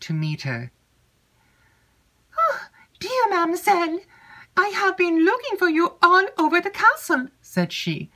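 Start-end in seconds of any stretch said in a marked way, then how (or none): 2.4-7.3 put-on much higher, eager young maid's voice; chattering maid Annette. Maid Annette eagerly seeking Emily, high excited chatter. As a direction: gush it out high and breathless with news.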